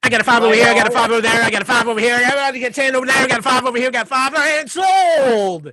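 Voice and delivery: loud, high, rapid auctioneer chant that slows hard on sold; auctioneer patter. Pretend. Cohost mocking the Aussie as an auctioneer, loud rapid patter. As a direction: rattle off bids like an auctioneer, then drag out sold. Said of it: "just some auctioneer".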